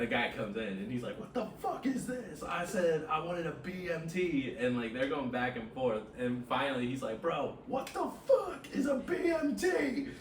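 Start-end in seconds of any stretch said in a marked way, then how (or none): 1.2-4.5 high-pitched irate customer imitation; mock yelling. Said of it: "they're yelling at each other". 7.2-10.1 high, drawn-out, louder outburst; mock exasperated yell. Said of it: "they're yelling at each other".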